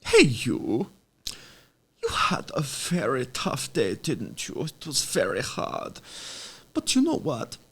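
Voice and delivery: put-on slow German-accented bedtime coaxing; goofy German boy as meditation guide, long pauses; Augustus Gloop. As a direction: soothe them to sleep in a silly, halting German accent. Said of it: "talk myself into sleep as Augustus Gloop".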